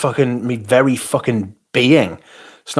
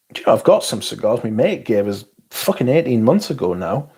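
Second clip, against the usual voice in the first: normal talking voice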